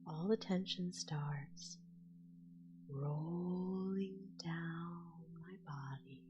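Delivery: slow, soft whispered meditation; whispered guided relaxation. Acted. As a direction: guide the listener into sleep, slowly.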